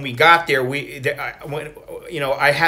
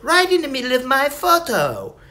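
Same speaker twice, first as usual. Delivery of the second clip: much higher, prim British woman voice; British lady impression; 10 semitones higher than usual. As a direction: play a dainty English girl wishing for a bird. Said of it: "the girl was like".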